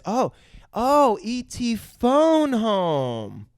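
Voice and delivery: high, very slow dawning realization; Drew. Retold or imitated. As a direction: stretch it out as a child working it out. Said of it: "before Elliot and says".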